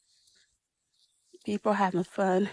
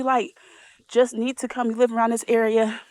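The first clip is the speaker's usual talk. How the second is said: normal talking voice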